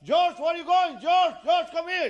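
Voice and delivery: frantic, very high, loud calling; shouting. Acted. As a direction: yell after someone who is running off. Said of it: "le empieza a gritar".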